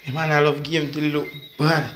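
ordinary talk, nothing marked

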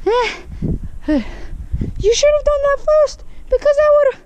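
high, far, whiny know-it-all; mocking commenter voice. Vlogger imitates commenters correcting him, whiny mocking falsetto. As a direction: mimic a smug critic in falsetto. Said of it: "You're gonna be like".

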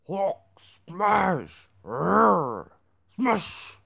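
loud monster bellow; Hulk roar. Retold or imitated. Hulk impression.

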